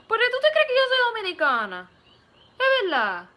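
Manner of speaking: loud, high, quick Dominican Spanish; Dominican accent. Shown as a demonstration. Streamer shows chat the Dominican accent she can do, in a loud Dominican voice. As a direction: put on a punchy, high-pitched Dominican accent. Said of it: "Dominican Republic one, which I just did".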